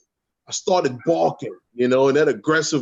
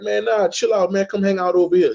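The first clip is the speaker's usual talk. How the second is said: much higher, lazy brush-off; higher, carefree lion waving off trouble; laid-back Simba; 8 semitones higher, faster than usual. Retold or imitated. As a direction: shrug her off and invite her to hang, pitched up. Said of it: "He like".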